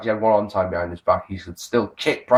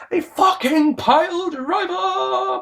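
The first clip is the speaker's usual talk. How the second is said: very high, drawn-out cry; high-pitched amazed outburst; astonished exclamation; much slower than usual. Real, not acted. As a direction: squeal it slowly in disbelief. Exclaims over an astonishing move.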